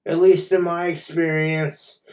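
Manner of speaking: ordinary talk, nothing marked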